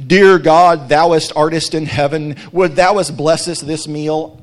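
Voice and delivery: loud, hammed-up old-fashioned church prayer, slowing at the end; mock pompous prayer. Pastor mocking a churchy lunch prayer, loud hammed-up pomp. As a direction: boom it out like a stiff religious pray-er parody. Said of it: "I'm making fun of when we do that".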